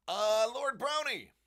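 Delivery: loud, high, drawn-out hail; calling out. Real, not acted. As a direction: call the lord's name out slowly. Calls out to a viewer.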